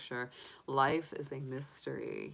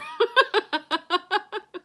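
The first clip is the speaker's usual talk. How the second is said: loud, high burst of laughter; big laugh; 9 dB louder than usual. Real, not acted. Laughing at her own Dracula pun, loud and high. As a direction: crack up at your own joke.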